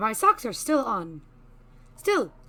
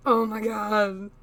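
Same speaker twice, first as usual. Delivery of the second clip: slow, loud smitten gush; drawn out and adoring; overwhelmed by cuteness; 6 dB louder, slower than usual. Real, not acted. Gushing over cute character.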